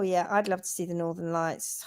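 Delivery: soft wistful whisper. Shown as a demonstration. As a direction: whisper it dreamily.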